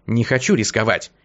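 usual speaking voice

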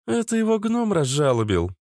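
teasing young woman, lightly mocking; high teasing female voice. A narrator's reading. Liana's teasing remark.

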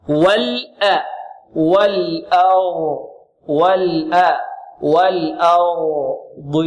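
very slow, syllable-by-syllable "والأرض" with heavy ra and dad; light hamza, heavy ra. Shown as a demonstration. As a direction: build "والأرض" piece by piece, long and deliberate. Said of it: "الضاد، هكذا". ("والأرض" ببطء بترقيق الهمزة وتفخيم الراء والضاد)

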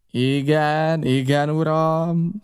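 slow, drawn-out aye-aye; obedient cook. A narrator's reading. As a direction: answer the captain with a stretched, rising acknowledgement. Cook answers captain's order. (elnyújtott, engedelmes válasz)

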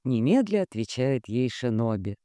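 plain reading voice

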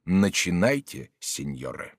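low, slow, stern voice; stern command. A narrator's reading. A stern voice signals the duellists to begin, stern and low. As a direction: order them to begin, low and unhurried. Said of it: "чей-то строгий голос сказал".

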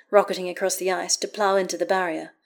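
plain reading voice

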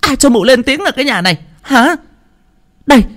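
high, furious bark; roaring. Acted. Mother-in-law roaring at the in-law to be silent, high and furious. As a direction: shut her up. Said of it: "lại gầm lên".

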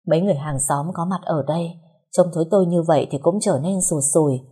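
usual speaking voice

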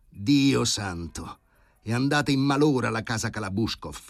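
desperate exclamation. A narrator's reading. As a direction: throw up your hands in despair. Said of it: "esclamò disperato". (esclamazione disperata, molto lenta)

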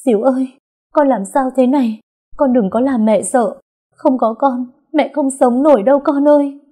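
high, loud tearful wail; desperate crying plea; crying mother. A narrator's reading. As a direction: wail over the sick child. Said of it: "vừa khóc lóc".

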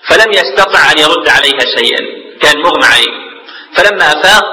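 ordinary talk, nothing marked

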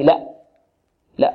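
ordinary talk, nothing marked

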